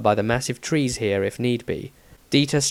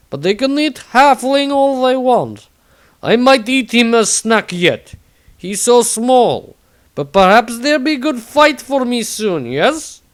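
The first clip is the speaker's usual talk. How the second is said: higher, louder gruff warrior; Tordrad voice; 12 semitones higher, 7 dB louder than usual. Acted. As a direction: declare it loudly like a proud warrior. Tordrad character voice.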